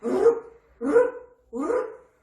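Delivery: loud, high rolled trill in three bursts; imitated zooming noise. Consultant mimicking the child racing around, loud trilled bursts. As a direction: roll out short rising bursts of noise.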